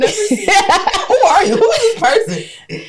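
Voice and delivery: high, drawn-out teasing through laughter; laughing mock disbelief. Real, not acted. Co-host teasing friend about new jeans, laughing disbelief. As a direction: tease your friend, incredulous and cracking up.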